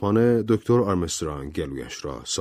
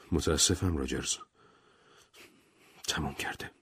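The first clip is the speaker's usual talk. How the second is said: soft, near-whispered condolence; hushed bad news; 4 semitones lower, 10 dB quieter than usual. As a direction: break the news gently, falling to a whisper. Doctor announces wife's death. (آرام و نجواگونه خبر مرگ را بده)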